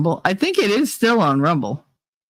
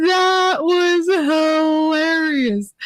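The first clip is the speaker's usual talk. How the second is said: high, long-drawn squeal; very slow, squeaky, out of control; real helpless laughter; 11 semitones higher, much slower than usual. Real, not acted. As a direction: squeeze the words out through laughter.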